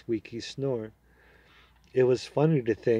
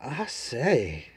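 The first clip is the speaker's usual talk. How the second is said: put-on the boy taken aback by the horse; shocked; 2 semitones higher than usual. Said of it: "in a rather shocked voice".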